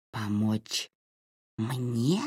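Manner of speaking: soft, low, sneering creature voice; eerie mocking voice. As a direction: turn the offer back on her. Possessed man mocks the offer.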